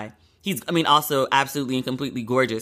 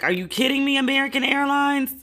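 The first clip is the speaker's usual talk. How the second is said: much higher, incredulous retort; exasperated disbelief; 11 semitones higher than usual. Retold or imitated. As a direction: throw the question at the airline in disbelief. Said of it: "And I was like".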